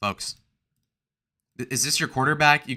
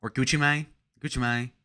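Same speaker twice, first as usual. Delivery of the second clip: ordinary talk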